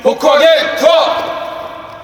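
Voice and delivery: loud echoed safety call; shouted check call. Real, not acted. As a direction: bark back the checklist item.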